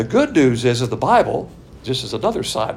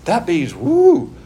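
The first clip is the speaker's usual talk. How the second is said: slow, loud admiring woo; gushing woo; 8 semitones higher, 5 dB louder, much slower than usual. Shown as a demonstration. Preacher showing attraction to the object, a loud slow woo. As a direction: let the woo swell with delight. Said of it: "there's something very attractive about that object".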